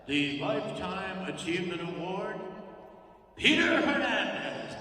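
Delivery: loud, slow announcer build to the name; award announcer. Retold or imitated. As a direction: call the winner's name like a gala host.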